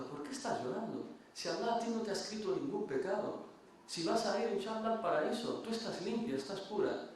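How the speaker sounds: put-on Hasan al-Basri consoling the dying girl, pitched high and gentle; gentle questioning scholar.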